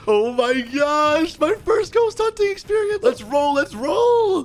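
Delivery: high-pitched hyped yelling; over-the-top gamer hype; excited. Real, not acted. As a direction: burst out thrilled, voice way up high. Thrilled about first ghost hunt.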